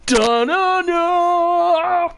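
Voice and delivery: high, slow dun-dun-dun; sung dramatic sting. Acted. After the courtroom twist, a high sung sting.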